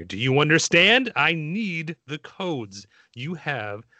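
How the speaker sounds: slow, deliberate villain threat; Agent Smith. Acted. Villain demanding the Zion codes, intense and measured. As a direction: drag out key words with theatrical menace. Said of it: "it has to be performed".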